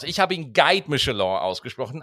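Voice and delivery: ordinary talk, nothing marked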